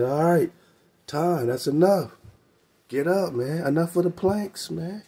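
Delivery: a teacher ending the drill; sensei calling time. Retold or imitated. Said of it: "your sensei said".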